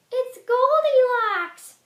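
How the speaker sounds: very high, slow, loud little bear; baby bear voice. Acted. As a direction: squeal it like a tiny cub. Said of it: "says the baby bear".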